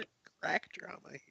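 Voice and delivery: high, fast, hushed put-on echo; mock announcer echo. Friend mimicking the narrator, high and fast. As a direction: toss the phrase back like a trailer line.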